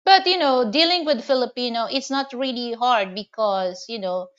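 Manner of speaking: ordinary delivery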